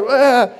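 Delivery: high, hammed-up toddler wail; mock baby wail. Believer throwing a tantrum at God, mock wail. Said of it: "you lay on the floor".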